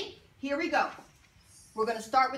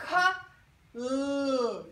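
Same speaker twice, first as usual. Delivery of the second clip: very slowly sound out "k-l" for the class. Shown as a demonstration. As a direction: stretch "k-l" apart so they can watch. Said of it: "look at my mouth when I say it".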